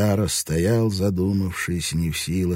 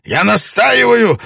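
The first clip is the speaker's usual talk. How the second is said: loud, much higher strained demand; desperate insisting; 10 semitones higher, 10 dB louder than usual. A narrator's reading. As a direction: force out each word in fury. Lord insisting, barely controlled.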